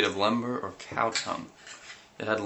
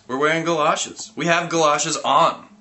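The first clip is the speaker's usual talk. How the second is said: louder, higher insisting; insistent; 7 semitones higher, 6 dB louder than usual. Acted. Narrator getting testy with the boy, insistent. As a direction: push the point harder.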